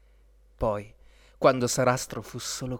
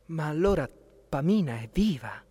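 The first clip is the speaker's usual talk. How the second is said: usual speaking voice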